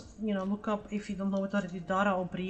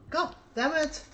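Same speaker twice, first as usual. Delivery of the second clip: sudden higher cry of frustration; mock outburst; 8 semitones higher, 4 dB louder than usual. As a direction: blurt it out like a thwarted parent. Mock parent outburst in joke.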